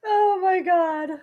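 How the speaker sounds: tearful laughing wail; laugh-crying. Real, not acted.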